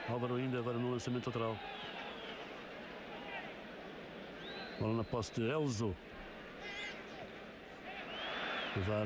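steady live play-by-play; football commentary. Real, not acted. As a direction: call the match as it unfolds.

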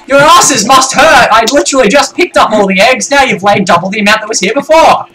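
high, loud mock scolding; exasperated yelling. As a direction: rant at the chickens in disbelief. Scolding chickens in the game.

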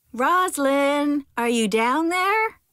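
high, drawn-out call down a hole. A narrator's reading. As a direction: call down to someone far below, slow and pitched high. Father calls down the hole.

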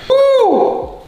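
high, loud whoop of joy; squealing cooking cheer; excited whoop. Real, not acted. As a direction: let out a happy whoop.

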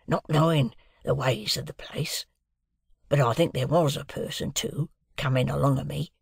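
a lightly voiced, hushed old convict; Magwitch the convict. A narrator's reading. Convict answering Pip at breakfast, lightly voiced and hushed. Convict figure in read novel.

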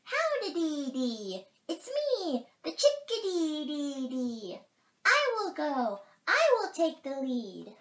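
very high, loud, drawn-out bird calls; perky chickadee. A narrator's reading. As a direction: sing out like a bold little bird. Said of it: "That's the chickadee".

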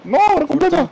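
calling out. Real, not acted. Calling out during match.

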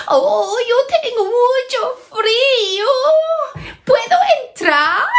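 high, whiny pleading hare; crying hare. Acted. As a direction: beg to come in, half sobbing. La liebre pide entrar. (liebre llorosa con voz muy aguda)

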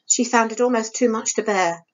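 ordinary delivery

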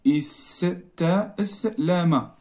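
very slow, every letter drawn out; استسلم stretched out slowly so pupils can write it; slow dictation. Shown as a demonstration. Teacher dictating the new verb to the class, very slowly. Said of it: "غتولي استسلم".

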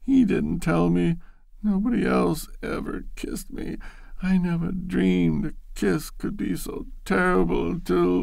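high, weepy confession broken by a sob; tearful. Acted. Loretta confesses to Bashford while crying, high and weepy. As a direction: sniffle through the explanation. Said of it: "Loretta was crying".

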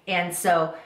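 ordinary talk, nothing marked

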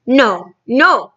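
mildly alarmed; protesting cry. A narrator's reading. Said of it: "cried the B F G".

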